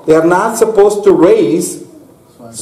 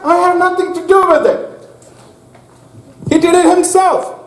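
high-pitched, startled devil's denial; protesting devil; 10 semitones higher than usual. Retold or imitated. Preacher voicing the devil blamed for what people did, protesting in high pitch. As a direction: protest innocence in a raised, incredulous voice. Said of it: "And devil said".

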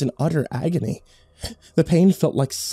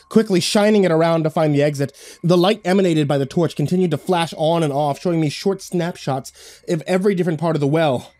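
plain reading voice

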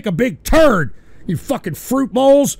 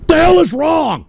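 angry shout; 5 semitones higher, 5 dB louder than usual. Real, not acted. Trolls again.

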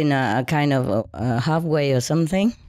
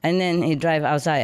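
ordinary talk

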